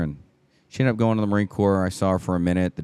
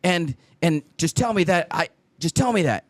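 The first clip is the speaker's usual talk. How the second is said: much higher, begging daughter voice; dad mimicking his daughter begging for harsh words; imitating his daughter; 12 semitones higher than usual. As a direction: plead like a scared teen girl wanting to be told off. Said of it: "She's like".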